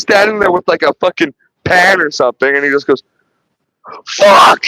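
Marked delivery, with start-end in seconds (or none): 4.1-4.6 mock yell. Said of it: "He just yells".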